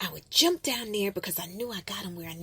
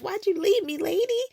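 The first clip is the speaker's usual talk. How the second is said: high, louder plaintive call after her; pleading call; 11 semitones higher, 7 dB louder than usual. Retold or imitated. Little girl calls after the lady who walked away, high and pleading.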